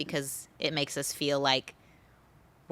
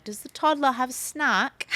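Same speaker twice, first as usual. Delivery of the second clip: put-on higher-pitched British woman's accent; 7 semitones higher than usual. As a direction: imitate the mentor's British accent. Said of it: "in her British accent".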